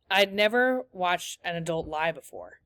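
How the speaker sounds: usual speaking voice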